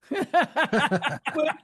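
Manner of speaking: giggling mid-joke; laughing. Real, not acted. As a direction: Whip squeaked high through laughter. Laughing while repeating word.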